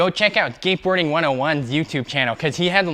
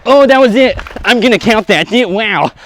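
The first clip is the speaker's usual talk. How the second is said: excited; 5 semitones higher, 9 dB louder than usual. Real, not acted. Finally lands the trick.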